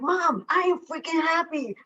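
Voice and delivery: high, bubbly burst of joy; reassuring worried mom, gushing with happiness; excited insistence. Retold or imitated. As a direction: squeal it out to mom, beaming. Telling mom she is happy.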